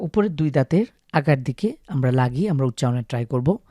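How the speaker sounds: ordinary delivery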